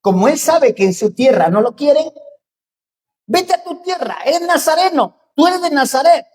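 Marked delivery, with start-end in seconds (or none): 3.2-6.3 high, loud jeering yell; demon yelling. Acted. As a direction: shout the taunt like a demon. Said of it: "le grita el demonio".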